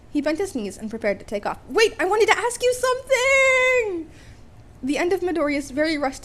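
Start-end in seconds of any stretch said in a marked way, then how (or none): 1.7-4.1 calling out. Acted. Boy calls after leaving hero.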